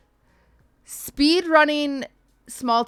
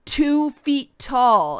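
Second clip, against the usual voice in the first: slow, drawn-out emphasis; dramatic reveal; 3 semitones higher, much slower than usual. Retold or imitated. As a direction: spell it out slowly for effect.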